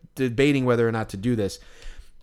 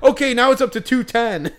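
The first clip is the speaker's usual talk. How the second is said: higher, louder mock hype; sarcastic announcing; 9 semitones higher, 5 dB louder than usual. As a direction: announce the tiny raise like big news. Crowd mocking the tiny raise.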